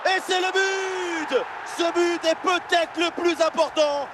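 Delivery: long drawn-out goal shout, high and slow; excited sports commentary. Acted. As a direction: roar the goal to the crowd. Goal scored in match commentary. (commentaire de but crié avec ferveur)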